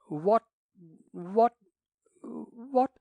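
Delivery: put-on flustered old man stammering. A narrator's reading. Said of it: "he stammered".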